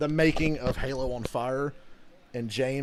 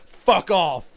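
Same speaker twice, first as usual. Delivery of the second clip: retold angry dismissal, louder and higher; genuinely pissed; 5 semitones higher, 9 dB louder than usual. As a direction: throw it out loud and irritated. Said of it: "he was genuinely pissed".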